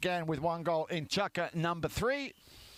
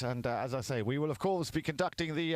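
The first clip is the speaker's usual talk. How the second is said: usual speaking voice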